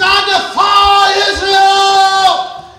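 loud, slow, drawn-out defiance; champion's challenge. Acted. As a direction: proclaim it across the battlefield. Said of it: "and he says".

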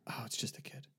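relieved whisper; whispering moviegoer. Retold or imitated. Imitating the woman behind seeing just a kid, whispered. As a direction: whisper it as an imitated cinema aside. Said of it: "I'm gonna do this quietly".

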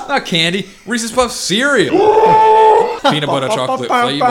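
high, loud hyped-up cereal pitch; mock advert shout. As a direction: belt it out like a cereal slogan.

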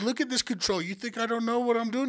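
cocky boast. Retold or imitated. Guard bragging to Ephraim about his gun control, cocky.

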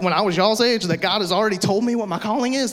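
normal talking voice

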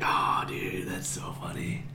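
whispery, low, deadpan fake appreciation; weary, hushed imitation of polite amusement; mock amusement. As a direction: feign being amused, barely voiced. Fakes amusement at stale cowbell joke.

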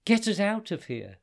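high, loud pleading; urgent plea. Acted. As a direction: beg to escape. Hudayfa pleads.

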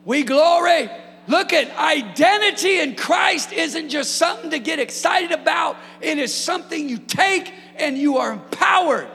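high, loud shouted preaching; preaching at full force. Acted. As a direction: lift the room to its feet, shouting. Preaching climax.

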